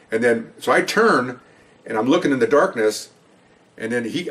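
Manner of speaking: ordinary delivery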